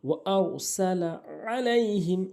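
slow "আরসালা" with full r, "আলাইহিম" with ain; rounded ra, ain. Shown as a demonstration. Teacher modelling the verse, "আলাইহিম" from mid-throat. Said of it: "আইনের উচ্চারণ করতে হবে".

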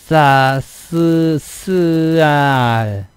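쓰알 stretched very slowly, s split from 알; trying the tense ss. Shown as a demonstration. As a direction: strain to force out 쌀. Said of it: "발음 안 되는 거". (아주 느리게 쓰-알 끊어 발음)

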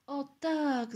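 high, loud cry of agreement; exclaiming. A narrator's reading. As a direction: call it out emphatically. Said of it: "zakrzyknął Tomek". (głośny, wysoki okrzyk)

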